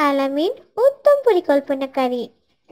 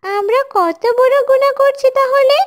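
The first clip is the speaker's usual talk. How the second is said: loud, higher-pitched dismay; acted alarm; 5 semitones higher, 4 dB louder than usual. Classmates dismayed to learn they have been sinning, alarmed. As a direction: exclaim in worried remorse. Children alarmed at their own sins.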